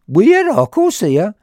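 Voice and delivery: high, loud acted bafflement; incredulous high-pitched where; baffled questioning. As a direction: act out puzzled searching. Retells own puzzled search.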